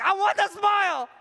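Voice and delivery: imitating people longing for that joy; eager longing quote. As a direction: cry out like someone who wants it badly. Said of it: "they're like".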